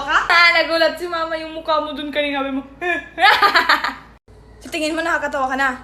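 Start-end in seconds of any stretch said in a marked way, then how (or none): 2.7-4.0 loud short laugh; child laughing at mom's face; burst of laughter. Acted. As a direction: let a laugh escape.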